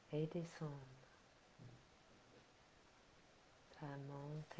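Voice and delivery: soft, unhurried relaxation guidance; guided meditation. Acted. Leads relaxation and breathing. (voix douce de méditation guidée)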